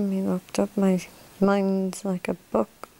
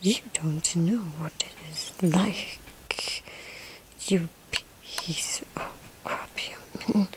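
whisper; 2 semitones lower, 6 dB quieter than usual. Acted.

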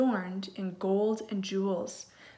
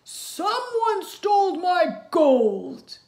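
much higher, louder, slow roar; roaring giant; 9 semitones higher, 9 dB louder, much slower than usual. Acted. Giant discovering his gold stolen, furious roar. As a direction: bellow it like a robbed giant. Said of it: "he roared".

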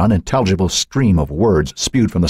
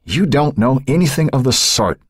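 raised-pitch irritable retort; growled; 9 semitones higher than usual. A narrator's reading. Husband contradicts his wife about their daughter, irritated growl. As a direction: contradict her sharply. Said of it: "Frank growled".

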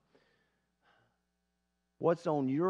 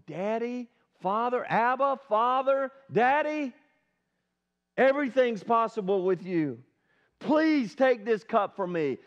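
higher, louder desperate calling out to Daddy; pleading with Father; 4 semitones higher, 4 dB louder than usual. Acted. Jesus crying out to his Abba, desperate pleading. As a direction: beg like a frightened son.

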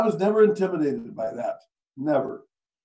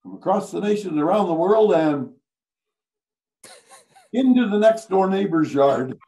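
raised-pitch mock radio announcer, louder on the botched ending; flubbed news intro; 4 semitones higher, 5 dB louder than usual. Radio storyteller imitating a colleague's botched on-air intro, mock announcer. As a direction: launch the news intro, then blunder on loudly. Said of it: "He goes on".

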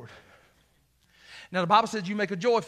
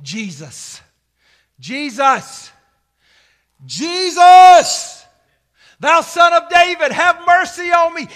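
building shout; 10 semitones higher, 15 dB louder, slower than usual. Acted. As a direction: cry out for help, each call bigger. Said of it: "And he began shouting".

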